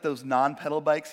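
ordinary talk, nothing marked

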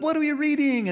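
high, loud eager question; bright keen newcomer asking. Pretend. To the book club friends, eager, high and loud.

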